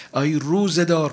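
calling out. Acted. Preacher calls out again.

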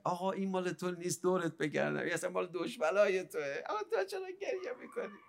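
tearful plea. Acted. Devotee begging the weeping Imam to stop, tearful plea. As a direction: plead softly, voice breaking upward at the end.

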